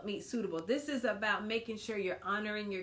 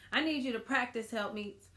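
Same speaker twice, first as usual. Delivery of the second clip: ordinary talk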